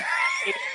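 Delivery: very high squeaky squelch noise; mimicking shoes squelching while running across the street; imitated squish sound. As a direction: squeak like shoes full of mush. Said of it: "all you hear is".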